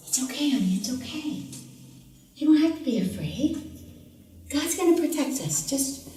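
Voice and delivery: soft, hushed reassurance; reassuring mommy. Retold or imitated. Mother calming her daughter after thunder, softly hushed. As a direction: quietly settle a frightened child back to bed.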